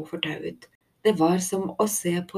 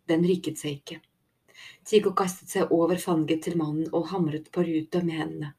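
ordinary delivery, nothing marked

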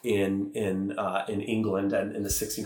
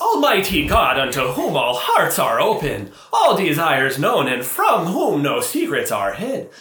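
put-on Irish accent; 6 semitones higher, 7 dB louder than usual. Mocking a celebrant's fake Irish accent, on request. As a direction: intone the prayer in a hammed-up Irish brogue. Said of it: "Can you give the voice for us?".

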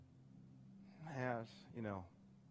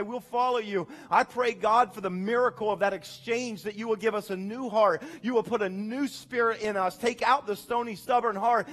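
pastor's prayer swelling louder and higher; earnest prayer; 6 semitones higher, 8 dB louder than usual. Acted. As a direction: pray over the church as they stand. Closing prayer over the congregation.

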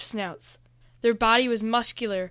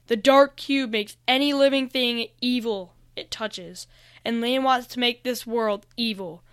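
ordinary delivery, nothing marked

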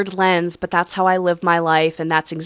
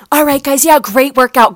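a higher, louder chirpy fitness-coach voice; peppy instructor; 8 semitones higher, 6 dB louder than usual. Retold or imitated. Mimicking the instructor's upbeat goodbye to the class. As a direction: imitate the bubbly instructor cheering the class. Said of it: "as she's like".

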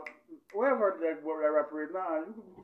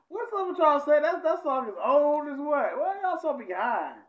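laughing; 9 semitones higher, 6 dB louder than usual. Real, not acted. Laughing, teasing the chat.

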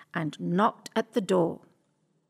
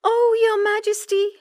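much higher, louder pleading cat; distressed cat; 12 semitones higher, 5 dB louder than usual. Acted. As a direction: plead with the king, upset. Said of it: "said Puss".

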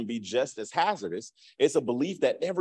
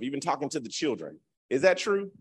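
ordinary talk

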